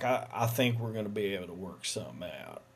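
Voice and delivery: soft, quick politician murmur; smooth it over quietly, fast; sly promise. Acted.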